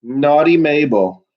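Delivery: ordinary talk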